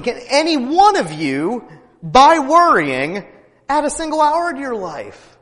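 high, loud preaching; fiery preaching. Acted. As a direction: preach it out to the congregation. Preaching at full force.

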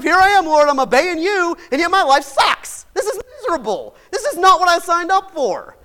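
high, loud griping at God; fed-up complaint. Retold or imitated. Preacher voices a fed-up believer griping at God, loud and high. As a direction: complain that obedience hurts. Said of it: "You ever get that way in life?".

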